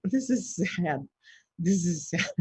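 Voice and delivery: slow, exaggerated whimper of "This is sad"; mock sad. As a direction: drag it out like a sulking child. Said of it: "I was just like".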